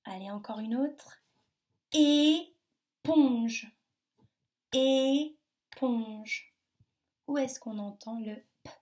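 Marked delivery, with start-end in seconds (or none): 1.9-3.7 ÉPONGE as a long é, pause, ponge; split into syllables. Shown as a demonstration. Teacher gives children a word, ÉPONGE split in two syllables. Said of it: "première ou dans la deuxième syllabe". 4.7-6.5 ÉPONGE as a long é, pause, ponge; split into syllables. Shown as a demonstration. Teacher gives children a word, ÉPONGE split in two syllables. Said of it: "première ou dans la deuxième syllabe".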